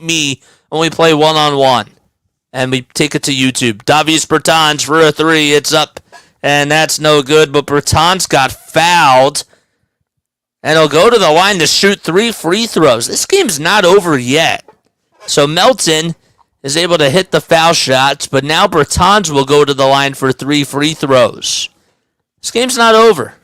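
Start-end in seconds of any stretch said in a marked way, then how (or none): 3.9-21.7 live game call; play-by-play. Acted. 22.4-23.3 excited. Real, not acted. Excited call, game tight.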